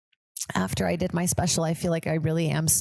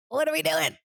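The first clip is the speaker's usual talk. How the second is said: high-pitched mock exasperation; squeaked-up exasperated question; exasperated outburst; 11 semitones higher than usual. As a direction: repeat it much higher, as if at wit's end. Acted exasperation, repeated higher.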